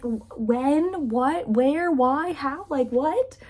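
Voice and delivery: high, loud volley of baffled questions; acted bewilderment. Friend stunned by news of a marriage wish, astonished. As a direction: fire off each question in mock astonishment.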